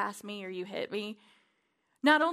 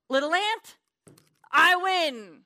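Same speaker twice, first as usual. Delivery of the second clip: defiant taunt; 6 semitones higher, 8 dB louder, slower than usual. Acted. Taunting the enemy.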